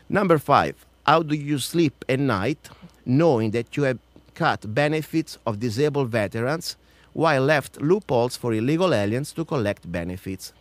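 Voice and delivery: formal read-out challenge. Acted. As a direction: read out a pointed question to a senator. Reads out numbered question.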